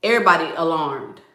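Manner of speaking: ordinary talk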